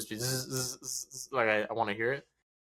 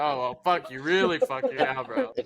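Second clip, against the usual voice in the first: mock retort; 6 semitones higher, 4 dB louder than usual.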